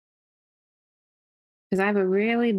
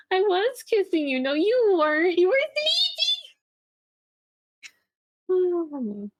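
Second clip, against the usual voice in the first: pouty whine; 10 semitones higher than usual. Retold or imitated. Pouty argument with sleepy partner.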